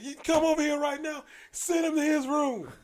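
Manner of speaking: high, loud barked orders; bossy high-pitched holler; imitating her yelling. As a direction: summon and dismiss at full volume. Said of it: "it's him she's yelling at".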